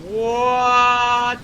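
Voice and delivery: loud, high shocked What; shocked shout. Real, not acted. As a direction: blurt it out in disbelief. Shocked outburst at game result.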